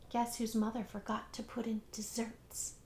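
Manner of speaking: sulky whispered aside; a hushed, hurt whisper. A narrator's reading. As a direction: whisper it like a grumbling secret. Aside about missing dessert.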